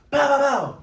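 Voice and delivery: loud punching noises bow bow; punch sounds. Retold or imitated. Imitating punches.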